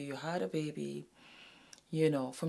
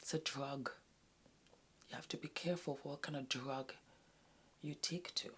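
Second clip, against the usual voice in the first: whisper; 2 semitones lower, 13 dB quieter than usual. Real, not acted. Warning, confiding.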